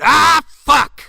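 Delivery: high loud curse; sudden hammed-up yell; mock outburst. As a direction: blurt it out in frustration. Acted outburst in scene.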